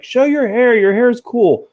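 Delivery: mock nagging, pitched well up; nagging fan. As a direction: imitate a pestering fan, high-pitched and insistent. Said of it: "has given me grief about that".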